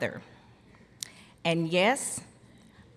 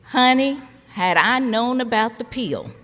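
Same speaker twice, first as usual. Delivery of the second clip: put-on louder, sassy Georgia mother putting her child in place; Georgia country accent; 2 semitones higher, 8 dB louder than usual. Mother teasing her youngest child, Georgia country accent. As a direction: imitate Mama's down-home Georgia accent with a laugh. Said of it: "again in her accent".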